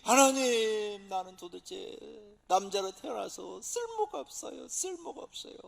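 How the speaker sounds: slow, drawn-out lament to God; mock wailing prayer. Fasting man wailing to God, slow hammed-up lament. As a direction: act out a man wailing he is useless. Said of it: "막 통곡하는 거죠".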